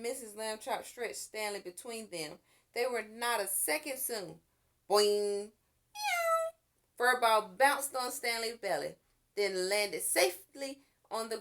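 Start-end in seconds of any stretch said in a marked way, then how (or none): 4.9-5.5 springy boing; boing sound. A narrator's reading. Cat bouncing off the boy's belly, springy boing. 5.9-6.5 very high meow; cat meow. A narrator's reading. Cat landing on the boy, meowing.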